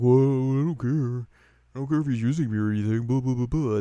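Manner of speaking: quick dismissive villain voice; voiced Bankotsu. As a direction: brush it off, cocky and fast.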